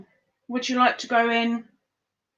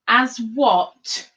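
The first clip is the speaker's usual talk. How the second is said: ordinary talk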